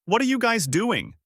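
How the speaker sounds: high-pitched yell at little extra volume; alarmed yell. A narrator's reading. Koby to his reckless new friends, alarmed yell. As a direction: cry out high in disbelief. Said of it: "Koby yelled out".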